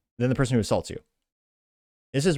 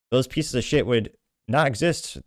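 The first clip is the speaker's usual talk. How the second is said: normal talking voice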